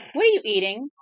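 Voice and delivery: loud high scold at the dog. Real, not acted. Owner scolding her dog for eating something, loud and high.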